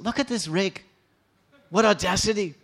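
a friend's indignant scorn; indignant Lalita. Acted. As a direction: scoff at him, outraged. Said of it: "she becomes very angry".

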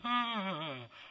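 long falling wordless moans; dazed groan. Acted. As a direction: imitate someone drugged on tranquilizers groaning. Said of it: "Then you walk around all day".

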